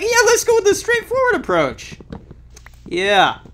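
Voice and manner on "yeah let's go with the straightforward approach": dumb voice. Retold or imitated. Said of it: "I was like".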